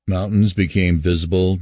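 plain reading voice

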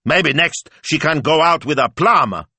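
loud, high sarcastic rant; mounting indignation, rising high; indignant outburst. A narrator's reading. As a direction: burst out incredulous and outraged. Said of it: "Signor Speranza burst out".

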